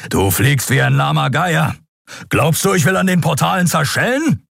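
high, scornful scolding; angry rebuke. Acted. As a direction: tear into her flying. Keru berates pilot.

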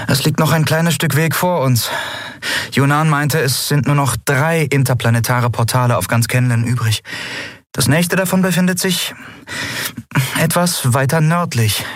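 brief the crew in a lifted character voice; captain breaking news to crew. Acted. Captain briefs her crew in the story.

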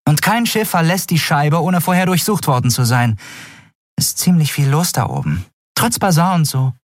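lightly voiced street companion; chatty Orin. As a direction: fill him in like a gossip. Orin reports the guards.

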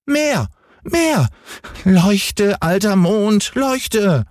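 put-on very high, demanding child's cry; Häwelmann crying out. A narrator's reading. Boy ordering the moon to shine, high childish cry. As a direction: demand it like an insatiable little boy. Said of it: "schrie Häwelmann".

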